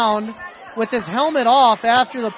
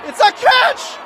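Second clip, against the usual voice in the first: excited shout; 11 semitones higher than usual. Real, not acted. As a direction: yell it like a big play just happened. Calling a big catch live.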